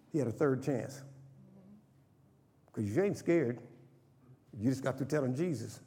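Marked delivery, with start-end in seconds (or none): none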